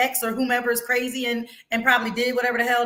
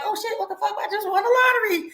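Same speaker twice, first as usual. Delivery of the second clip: much higher, mock stunned delight; shocked joy; 11 semitones higher than usual. Host imitating someone who just won the lottery, high and thrilled. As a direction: act out the lottery winner's gasp of disbelief. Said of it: "what the fuck can be like".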